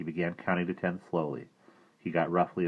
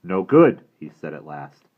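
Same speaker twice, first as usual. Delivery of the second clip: put-on loud, stern brave scolding the boy; a proud warrior unimpressed by fuss; disapproving Indian brave; 4 semitones higher, 10 dB louder than usual. Indian and Omri dialogue.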